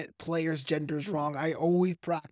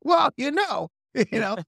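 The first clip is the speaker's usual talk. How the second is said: imitating Chris Rock; 6 semitones higher, 5 dB louder than usual. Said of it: "it's up to Chris Rock to be like".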